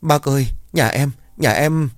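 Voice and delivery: high, broken sobbing plea; tearful, choked up. Acted. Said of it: "Nghe có tiếng khóc".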